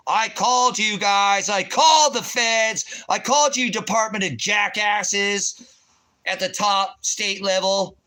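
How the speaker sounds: high, loud, slow yelling; shouting. Retold or imitated. Ranting at the feds, high slow shouting. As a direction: yell each called at them. Shouting his own retold words.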